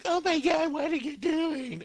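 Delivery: high-pitched, drawn-out mock outrage; shocked anime girls. Podcaster acting out the girls waking up, mock shock. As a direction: squeal it like a startled cartoon girl. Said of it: "the girls wake up".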